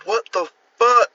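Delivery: high, loud incredulous outburst; can't believe what he's seeing; incredulous what the fuck. Retold or imitated. Baffled by the crazy tweets, a high, loud incredulous cry. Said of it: "It's like".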